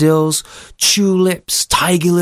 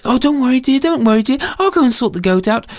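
higher-pitched storybook mother; reassuring mother; 9 semitones higher, faster than usual. Acted. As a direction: soothe a scared child, taking charge. Storyteller voices soothing mother.

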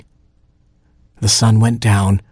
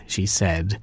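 usual speaking voice

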